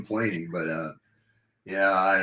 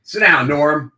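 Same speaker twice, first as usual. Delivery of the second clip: yelling; 7 semitones higher, 9 dB louder, slower than usual. Retold or imitated. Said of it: "a specific call you had".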